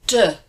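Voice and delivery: de with a neutral unstressed vowel; de said short and neutral; French de. Shown as a demonstration. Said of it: "That E is a neutral vowel".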